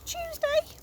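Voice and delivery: very high eager guess; boy guessing again; Jeremiah guessing. A narrator's reading. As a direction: pipe it up hopefully. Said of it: "Jeremiah said".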